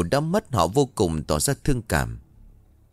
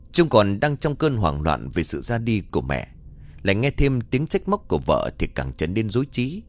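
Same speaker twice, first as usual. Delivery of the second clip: ordinary delivery, nothing marked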